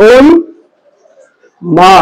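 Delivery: ওম মা sounded out in two parts; pronunciation demo. Shown as a demonstration. Teacher sounding out the word for students, two parts. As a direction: split ওম মা with a pause between. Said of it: "তাহলে কি হবে".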